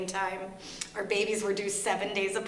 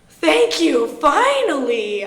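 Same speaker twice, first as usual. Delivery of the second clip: loud, drawn-out thank you, much higher; relieved loud thanks; 7 semitones higher, 12 dB louder, much slower than usual. Retold or imitated. To her husband who finally shows grief, a loud relieved thanks. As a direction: burst out with relief, stretching finally slowly.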